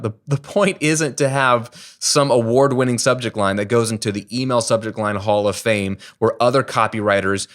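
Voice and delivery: normal talking voice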